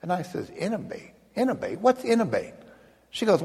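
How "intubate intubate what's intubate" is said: retold bewilderment, pitched well up; baffled questioning. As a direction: act out a puzzled patient, voice jumping high. Retold baffled patient questioning nurse.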